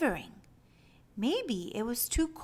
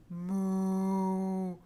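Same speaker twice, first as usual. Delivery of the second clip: a gentle cow lowing; mooing cow; 4 semitones lower than usual. Acted. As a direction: moo like a cow. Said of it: "Cows mooed".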